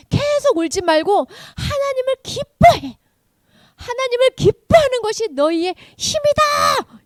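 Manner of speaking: high, emphatic exhortation; rally the weepers to rejoice; rousing priest. Acted. Priest telling weeping people to rejoice in God, rousing.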